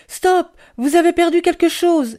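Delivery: high, loud call to someone far off; shouting. A narrator's reading. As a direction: shout it out urgently after them. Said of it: "ai-je crié".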